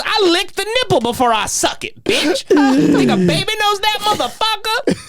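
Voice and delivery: high-pitched indignant imitation; mock angry boasting. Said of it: "he goes".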